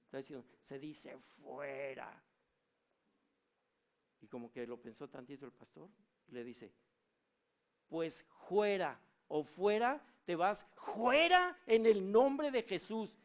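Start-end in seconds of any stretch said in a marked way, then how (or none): 0.6-2.2 very slow, strange demonic voice; a demon stubbornly correcting, drawn out. Retold or imitated. 7.9-13.2 high, loud, slow rebuke of a demon; commanding shout. Retold or imitated. Pastor orders demon out.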